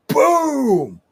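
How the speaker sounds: high, loud falling boom; shouted impact. Acted. Wrestler acting out the arm being yanked, explosive shout. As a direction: hit it like a sudden blow.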